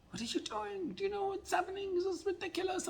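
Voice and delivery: high, strained imitation of a yelling parent; mock angry scream. Parent yelling at teenage son, mock scream. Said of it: "start screaming at him".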